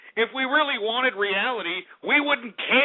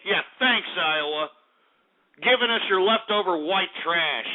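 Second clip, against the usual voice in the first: normal talking voice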